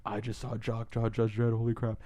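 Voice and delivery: hushed fan disbelief, held in; awed hush. Retold or imitated. Fan who just watched Jock draw Dredd, awed hush.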